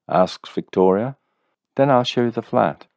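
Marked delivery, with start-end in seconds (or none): 1.8-2.9 higher, fussing woman; lightly voiced landlady. A narrator's reading. Landlady seeing the soaked visitor, mildly fussing. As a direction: fuss over him a little.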